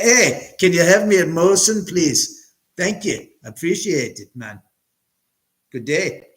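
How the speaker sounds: mock a polite Canadian ordering a beer; Canadian accent. Ordering a Molson like a polite Canadian, in a mock Canadian accent. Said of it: "you're doing a Canadian accent".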